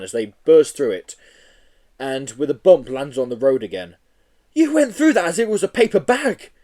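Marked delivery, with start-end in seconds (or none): 4.5-6.5 amazed gasp. Acted. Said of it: "gasped Joe".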